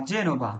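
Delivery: জেনোভা with a J onset; soft G as J. Shown as a demonstration. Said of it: "জি এর পরে এ আছে".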